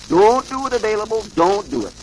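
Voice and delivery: high, stern warning from above. A narrator's reading. Voice in the thunder warns Available, sternly. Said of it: "a stern voice seemed to say".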